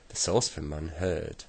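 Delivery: plain reading voice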